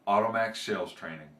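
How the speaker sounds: lightly voiced company tagline; ad read. Trainer closes the joke with a company pitch, ad read. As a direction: sign off the commercial, measured.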